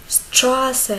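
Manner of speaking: ordinary talk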